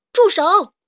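high, forceful shouted command; loud shout. A narrator's reading. Carmelito swoops down on the kidnappers, shouting loudly. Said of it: "大喊一声".

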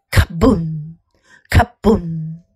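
low, heavy booming thump; heartbeat booms. A narrator's reading. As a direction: boom it like a pounding heart. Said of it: "her heart was going".

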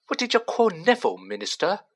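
quick, high, eager question; excited Stan. A narrator's reading. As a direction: blurt it out fast and thrilled. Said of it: "he said excitedly".

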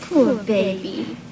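tender pity. Acted. Pitying the lost dog.

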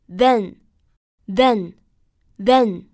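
"then" three times, slowly spaced, short e; model repetition. Shown as a demonstration. Teacher leads students repeating "then", slow, short e. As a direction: drill "then" with a short vowel, pausing between each. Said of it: "跟老师来读一下".

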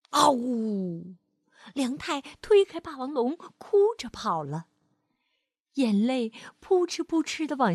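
put-on gentle storyteller reading to a child; lively read-aloud for kids; expressive bedtime-story voice; story narration. A narrator's reading.

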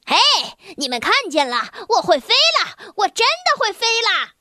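high, thrilled cry; a boy shouting his wonder; excited shout. Acted. The boy runs to the others crying out in high delight. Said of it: "叫喊着向其他人跑去".

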